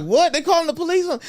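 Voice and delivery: high-pitched startled question; mock alarm. Dude asking Mike about police, mock alarm. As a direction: jump up in pitch, taken aback.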